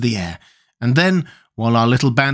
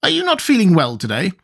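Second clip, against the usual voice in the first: higher, concerned character voice; Tin Woodman's concern; 8 semitones higher than usual. A narrator's reading. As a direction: ask with mild concern, pitched up. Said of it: "strongly affected by this sad speech".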